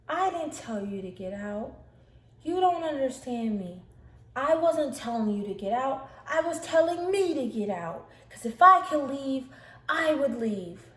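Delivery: loud, high-pitched imitated outburst; agitated protest. Sister insists she meant herself, agitated and loud. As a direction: protest wildly, stressing who was meant.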